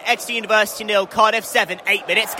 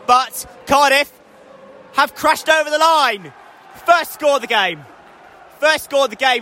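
raised pitch on a live score call; excited try call; 5 semitones higher than usual. Real, not acted. Commentator tells listeners Cardiff crashed over, excited.

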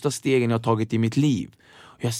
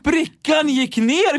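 stunned joy; 12 semitones higher, 4 dB louder, much slower than usual. Retold or imitated. Thrilled the tray fits.

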